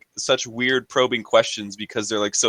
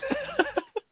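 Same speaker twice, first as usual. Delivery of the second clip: high laugh; 9 dB quieter than usual. Real, not acted. Laugh after spicy food joke.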